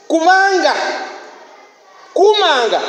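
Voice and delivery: high, loud preaching warning; forceful warning. Real, not acted. Preacher warns the church to guard their mouths, loud and high. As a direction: thunder the warning at the congregation.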